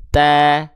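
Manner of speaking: held TA with a thin A; smiling thin ta. Shown as a demonstration. Teacher modelling for learners, thin smiling ta. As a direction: open the mouth in a slight smile on ta. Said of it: "buka mulut, sedikit senyum".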